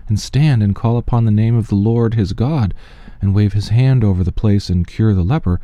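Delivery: ordinary talk, nothing marked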